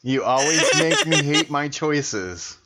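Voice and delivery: usual speaking voice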